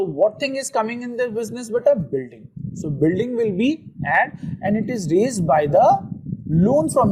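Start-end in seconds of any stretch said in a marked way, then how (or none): none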